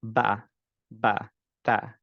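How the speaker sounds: তা opened upward. Shown as a demonstration. Teacher modelling the correct তা. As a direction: say তা open and clean. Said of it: "এরকম পড়বো".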